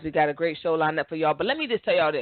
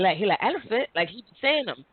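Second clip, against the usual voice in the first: ordinary delivery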